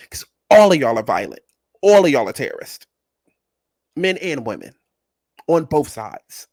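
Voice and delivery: loud declaration, all hit hard; loud rant. Real, not acted. Calling out all her viewers in a loud rant. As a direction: yell it at the audience.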